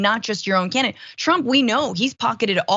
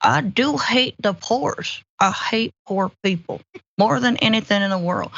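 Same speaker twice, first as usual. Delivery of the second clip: Mitch McConnell. Acted. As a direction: drag out key words in a hammed-up senator impression. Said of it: "I could do a very good Mitch McConnell".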